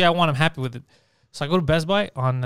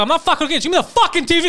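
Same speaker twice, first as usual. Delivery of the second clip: high, raised mock rant at the clerk; retold angry outburst; 9 semitones higher, much faster than usual. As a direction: replay the blow-up at the salesman, pitched way up. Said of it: "Like, I went crazy".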